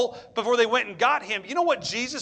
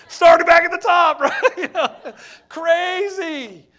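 high, loud words broken by laughter; laughing; 8 semitones higher, 9 dB louder, slower than usual. Real, not acted. Preacher laughing at his own sermon story, cracking up.

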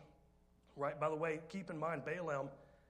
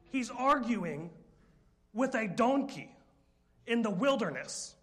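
emphatic preaching; 6 semitones higher, 9 dB louder, slower than usual. Real, not acted. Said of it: "emphatic preaching".

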